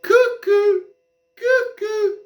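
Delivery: very high, sing-song cuckoo bird call. Pretend. Mocking immigrants as mad, high cuckoo-clock call. Calling people mental.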